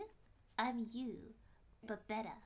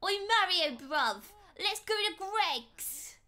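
loud, slow hammed-up British accent; 5 semitones higher, 5 dB louder, slower than usual. Shown as a demonstration. Streamer shouting to Mario, over-the-top British accent. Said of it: "go ham on the British accent".

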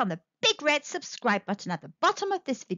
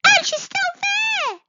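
very high, loud cub shriek; bear cub; 7 dB louder than usual. Acted. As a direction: squeal it like an alarmed cub. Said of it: "Baby Bear's tiny voice".

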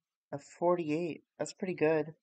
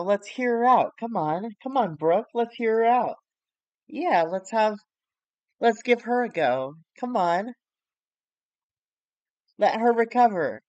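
higher, louder sing-song mockery; mocking; 5 semitones higher, 5 dB louder than usual. Two wrestlers mocking Brooke in the ring, higher sing-song taunting. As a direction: taunt her with fake encouragement. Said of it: "basically mocking her".

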